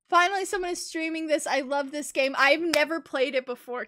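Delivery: ordinary talk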